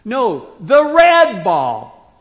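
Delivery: slow, loud, red punched hard and high; stress on red. Shown as a demonstration. Parent to child picking toys, stressing red. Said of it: "we slightly do that in English".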